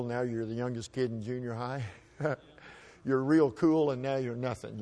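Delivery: usual speaking voice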